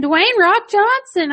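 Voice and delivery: put-on high, slow little-kid voice; high child voice, drawn out; imitating a little kid. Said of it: "he kept saying".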